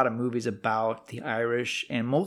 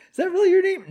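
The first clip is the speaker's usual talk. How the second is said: very high, loud mock disbelief; hammed-up high-pitched incredulity; incredulous doubter; 7 dB louder, faster than usual. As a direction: squeal the question like an imagined sceptic. Voicing an imagined doubter.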